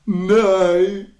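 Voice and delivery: high shrieking cries of despair. Real, not acted. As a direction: scream in laughing horror. Draws the dreaded cat food.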